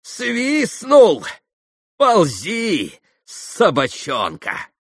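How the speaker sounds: high outburst falling to slow contempt; wild self-scorn, descending; bitter cry. A narrator's reading. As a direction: cry out, then spit the words slowly. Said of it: "прокричала она".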